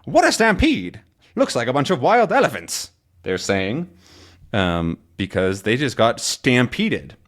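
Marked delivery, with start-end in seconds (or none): 0.0-2.9 higher-pitched mock astonishment; excited exclamation. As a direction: exclaim it like a comic-book character. Acts out characters' exclamation from comic.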